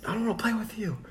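put-on small, high, soft voice of a sulking kid; retold sad refusal. Retelling the sad boy refusing Air Bud, sad. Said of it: "really sad".

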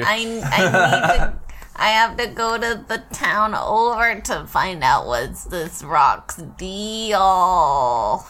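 slow character voice with stretched endings; trying out character voice. Acted. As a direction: drag out the last word in character. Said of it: "I don't know my voice".

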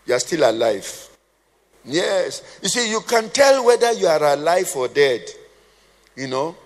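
normal talking voice

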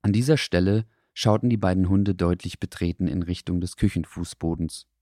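ordinary delivery, nothing marked

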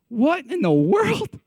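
mock-appalled onlooker; flabbergasted. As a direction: draw it out in disbelief. Outsiders overhearing a couple's fight.

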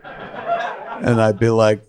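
normal talking voice

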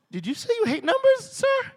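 very high, loud disbelief; shocked question. Retold or imitated. Preacher shocked by the accountant, high squeal. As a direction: squeal it in amazement.

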